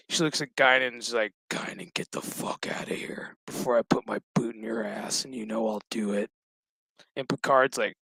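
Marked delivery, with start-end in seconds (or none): 1.5-6.3 low, hushed mock menace; soft, whispery warning; whispered threat. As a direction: play a quiet threat under the breath. Girl threatens Guinan in retelling.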